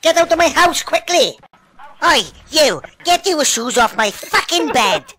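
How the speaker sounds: usual speaking voice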